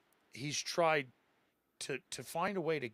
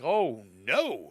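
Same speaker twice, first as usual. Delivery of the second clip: louder, swooping cry of dismay; mock dismay; 6 dB louder than usual. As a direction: imitate a policeman aghast at a monster. Policeman reacting to the monster.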